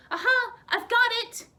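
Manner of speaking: high, loud eureka cry; excited shout. Acted. Nellie shouting to Orville, excited loud cry. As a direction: burst out with the discovery. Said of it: "Nellie shouted".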